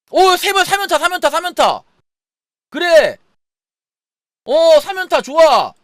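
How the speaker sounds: high, loud thrilled chant; excited. Real, not acted. Triple hit in gacha.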